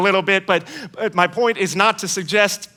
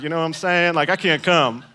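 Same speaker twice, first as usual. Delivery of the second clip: usual speaking voice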